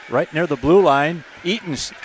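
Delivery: ordinary talk, nothing marked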